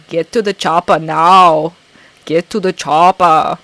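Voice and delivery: loud, slow action-hero impression; Arnold Schwarzenegger. Quoting the action star on viewer request, loud impression. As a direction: bellow it with a heavy Austrian-style accent. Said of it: "for all the Arnold quotes".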